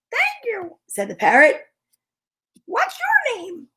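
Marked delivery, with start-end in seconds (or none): 0.1-0.8 high squawky parrot voice, much higher. Acted. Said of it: "I get to do a parrot voice". 2.7-3.7 much higher, slow squawky parrot. Acted. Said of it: "I get to do a parrot voice".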